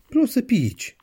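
put-on very high, loud small child's voice; little grandson. Grandson asks grandma for a drink, very high child voice. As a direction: ask like a small insistent child, high-pitched. Grandson repeats his request.

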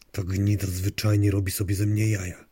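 plain reading voice

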